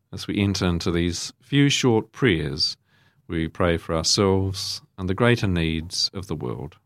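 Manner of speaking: measured liturgical reading with long pauses; formal prayer. Real, not acted. Priest leading the congregation in prayers for others, solemn and measured. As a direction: lead the intercessions in solemn, spaced phrases. Leads the intercessions.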